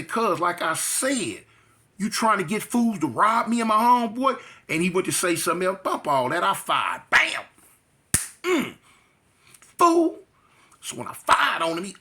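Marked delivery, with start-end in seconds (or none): 0.0-4.4 confronting. Retold or imitated. Confronting him in the cell. 7.1-7.4 sharp high shouted bam; imitated punch bam. Recounting the fight, a loud high punch bam. Gunshot sound in a fight story. 8.4-10.2 sharp high shout of fool; yelled fool. Retold or imitated. Mid-fight retelling to the listener, a loud high yell.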